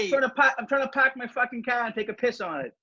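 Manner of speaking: a pretend Boston dog griping about his owner in a high Boston accent; tough Boston terrier. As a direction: rant like a rude Boston dog. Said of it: "Boston Terriers are like".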